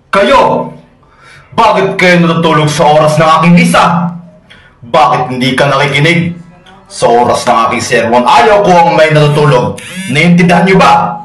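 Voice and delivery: indignant priest rebuking sleepers, pitch jumping up; angry scolding. Acted. Friar catches his flock sleeping during Mass, angry scolding. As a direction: berate the congregation from the pulpit.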